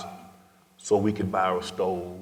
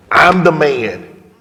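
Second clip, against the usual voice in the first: louder, higher and very slow; heartfelt declaration; 8 semitones higher, 11 dB louder, much slower than usual. Retold or imitated. To his dying grandmother, thanking her, loud and slow. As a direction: drag each word out with fierce pride.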